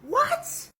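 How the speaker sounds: pitched very high, disbelieving; hearing her friend fled the country, high shocked; shocked what. Retold or imitated. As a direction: gasp it out in shock. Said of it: "I'm like".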